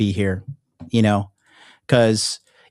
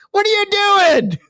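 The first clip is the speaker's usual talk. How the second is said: much higher, slow yell breaking into laughter; laughing mock shout. Repeating his yell at the surgeon, laughing through it. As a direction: yell it again but crack up.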